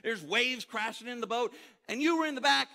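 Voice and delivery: high and loud, slowing on napping; really animated. Pretend. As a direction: tell the storm story all worked up. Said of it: "I'd get really animated when I tell".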